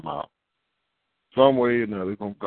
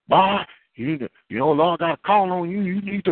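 mom urging; 8 semitones higher than usual. Retold or imitated. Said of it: "My mom come in".

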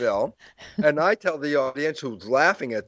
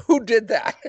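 laughing; 8 semitones higher than usual. Acted. Mock puzzlement, laughing at himself.

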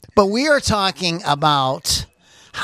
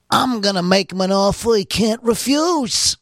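Tony Soprano voice; 6 semitones higher than usual. Acted. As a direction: play the big mob boss making a threat. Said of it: "something more along the Tony Soprano kind of".

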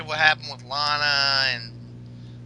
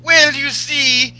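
put-on higher, louder Russian-accented woman; Russian accent Lana; 8 semitones higher, 6 dB louder than usual. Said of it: "Lana's like".

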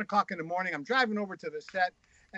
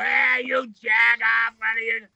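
loud, slow, high mock holler; trucker's yell; 5 semitones higher, 7 dB louder, slower than usual. Trucker heckling the driver, loud mock yell. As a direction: call out from a passing truck.

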